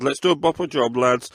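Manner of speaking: a superintendent directing a manhunt, loud and commanding; commanding shout. Acted. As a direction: rap out orders to the lads. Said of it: "he barked, full of command".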